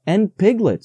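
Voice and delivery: a quick happy cry; excited. A narrator's reading. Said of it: "said Christopher Robin excitedly".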